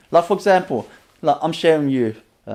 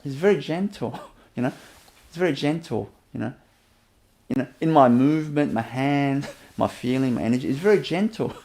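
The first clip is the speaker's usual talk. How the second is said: ordinary talk, nothing marked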